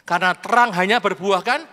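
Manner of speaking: loud unison scripture reading; congregational reading. Real, not acted. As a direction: read the verse aloud together. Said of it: "Kita baca bersama ya". (membaca ayat bersama dengan lantang)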